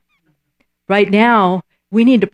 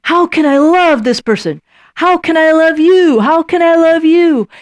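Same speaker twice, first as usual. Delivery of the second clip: rising, louder, eager self-pep talk; stirring yourself up; 9 semitones higher, 4 dB louder than usual. Retold or imitated. Preacher voicing someone stirring themselves to love, high and loud. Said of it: "And you're just inciting yourself".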